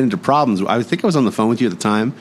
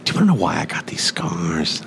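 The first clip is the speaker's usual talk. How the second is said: low whispered mock menace; menacing villain; 3 semitones lower, 4 dB quieter than usual. Villain asking about his scars, whispered menace. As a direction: whisper it like a creepy movie villain.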